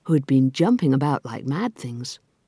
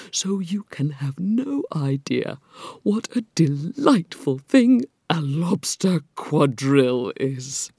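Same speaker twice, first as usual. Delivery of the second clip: slow, savoring creature voice; drawn-out, wistful storybook turtle; mournful Mock Turtle; slower than usual. A narrator's reading. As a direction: relish each word slowly, as the Mock Turtle.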